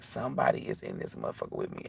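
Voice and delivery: breathless whisper, barely any voice; scared whisper. Retold or imitated. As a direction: whisper it like someone's hiding in the room. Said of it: "I'm like".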